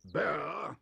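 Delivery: loud bellowing shout. A narrator's reading. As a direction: bellow the name in rage. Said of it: "bellowed Mister Curry".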